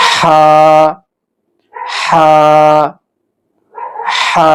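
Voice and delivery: "হা" squeezed from the middle of the throat; constricted Arabic "হা", one at a time; squeezed pronunciation. Shown as a demonstration. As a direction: push each held "হা" through a tightened throat. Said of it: "চিপিয়ে".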